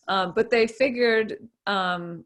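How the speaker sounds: usual speaking voice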